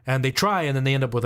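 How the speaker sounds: ordinary delivery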